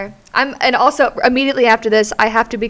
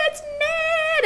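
high mock driver shout; imitating the noon cheer; bus driver cheer; 4 dB quieter than usual. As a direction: sing it out like the driver. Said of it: "she's like".

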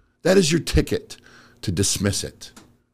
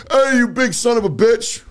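put-on drunk angry guy; 11 semitones higher than usual. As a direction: bark it like a hammered stranger. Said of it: "Guy's hammered".

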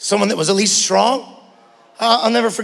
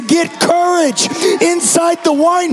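whooped sermon; 6 semitones higher than usual. Acted. Pastor urging the church to take courage, sung and shouted. Sung preaching over music.

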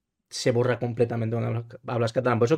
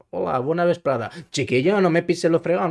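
a Valencian greeting in a put-on Andalusian accent, pitched up; mock Andalusian accent, higher and louder; 6 semitones higher, 4 dB louder than usual. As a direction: playfully imitate an Andalusian speaking Valencian. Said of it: "El tema de di-, de decir".

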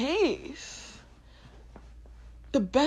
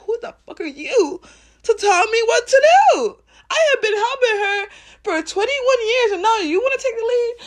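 higher and louder, outraged rant; offended old boss yelling; indignant brain; 10 semitones higher, 7 dB louder than usual. Retold or imitated. Brain yelling at the heart, high, loud and outraged. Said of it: "My brain's like".

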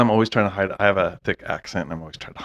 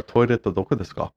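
Japanese phrase; 3 semitones higher than usual. Shown as a demonstration. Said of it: "Japanese phrase".

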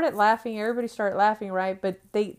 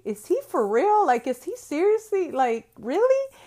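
high, louder retold disbelief; incredulous; 8 semitones higher, 4 dB louder than usual. Mother reacting to her son's ridiculous story, incredulous. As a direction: act out baffled questioning, pitched up.

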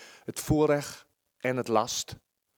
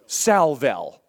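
louder, higher defiant snap; defiant retort; 4 semitones higher, 7 dB louder, slower than usual. Retold or imitated. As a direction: put your foot down. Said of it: "Ze zeggen".

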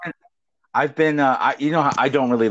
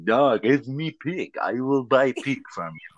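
Yugoslavian buyer; slower than usual. Retold or imitated. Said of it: "Yugoslavian's like".

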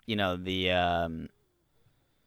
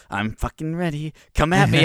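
louder, higher, cocky king calling out; mock battle cry; 6 semitones higher, 9 dB louder than usual. The king daring the assassin, mock defiant yell. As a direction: shout it like a cocky fighter. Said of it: "he's in the middle of being like".

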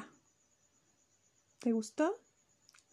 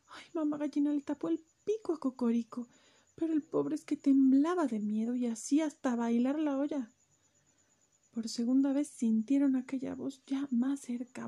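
plain reading voice

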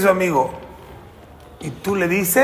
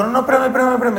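higher, louder pleading at the start; urgent plea; 7 semitones higher, 4 dB louder, much faster than usual. Acted. Robbed man stopping the thief, urgent plea. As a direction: plead hurriedly for them to wait.

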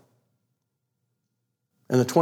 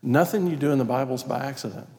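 low, soft, measured prayer; closing prayer; 5 semitones lower, 5 dB quieter than usual. Real, not acted. Preacher thanking God for the congregation, quiet prayer. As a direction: pray quietly and earnestly, with pauses.

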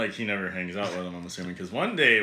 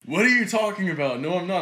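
higher-pitched protest, acted out; indignant denial; 6 semitones higher than usual. Friend denying he gets picked up by dudes, indignant. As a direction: mimic a man protesting his innocence.

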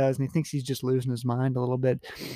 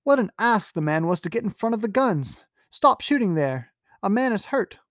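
annoyed nobleman; 7 semitones higher than usual. Acted. Sir Geoffrey shouting orders.

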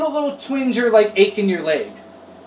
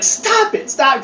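scolding family; 6 semitones higher, slower than usual. Retold or imitated. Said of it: "They're like".

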